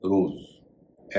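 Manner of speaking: रोज said very slowly, with a z at the end; धीरे से रोज, अंत में ज़; slow pronunciation demo. Shown as a demonstration. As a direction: stretch out रोज, ending on z. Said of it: "पहला शब्द है आरओएसी".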